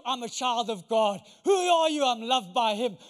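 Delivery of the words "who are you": preacher's call. Acted. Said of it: "call and response".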